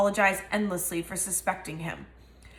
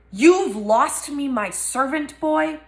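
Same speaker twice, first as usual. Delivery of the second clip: higher, louder and slow, spitting rage; furious accusation; 6 semitones higher, 5 dB louder, slower than usual. Acted. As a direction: lunge at him with every word. Malfoy lunges at Harry in rage.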